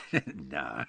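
low, soft chuckling; small, low laugh as you speak; weak chuckle. A narrator's reading. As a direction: chuckle feebly into the word. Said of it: "gave a weak chuckle".

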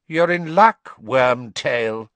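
high, loud, cold master; cold high-pitched voice. A narrator's reading. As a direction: address the servant in a cold, high voice. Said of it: "said a cold, high-pitched voice".

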